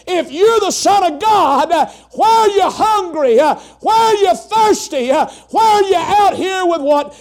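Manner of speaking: high, loud mocking shout; taunting devil. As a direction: jeer at him, shouting each why. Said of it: "the next words he hears".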